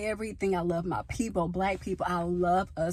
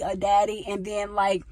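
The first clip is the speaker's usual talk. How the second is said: usual speaking voice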